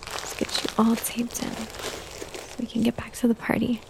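slow, soft breathy whisper. Acted. Mother settling a sleepy child, slow hushed whisper. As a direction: hush it as if she is drifting off.

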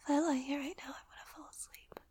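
drowsy, unvoiced whisper fading away; real sleepy whisper while lying down. Real, not acted. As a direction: whisper as if dozing off. Drowsy lying comfy, about to sleep.

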